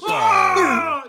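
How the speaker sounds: loud, high monster wail; hammed-up beast howl of frustration; tantrum scream. Bigfoot tantrum.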